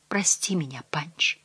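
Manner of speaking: soft plea sinking into a whisper; faltering whisper. A narrator's reading. Mother begging her son's forgiveness, faltering whisper. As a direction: let the apology fade to a broken whisper. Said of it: "Голос дрогнул, перешёл в прерывистый шёпот".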